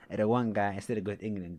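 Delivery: normal talking voice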